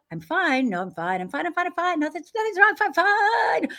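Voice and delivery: rapid, rising into high sing-song denial; mock-cheerful cover-up, pitch soaring; outside self insisting. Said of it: "The outside [name]".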